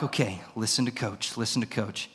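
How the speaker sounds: low, soft repeated reminder; focused self-talk. Retold or imitated. Wrestler to himself as round two begins, quietly focused. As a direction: imitate coaching yourself under your breath.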